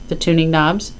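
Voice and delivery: usual speaking voice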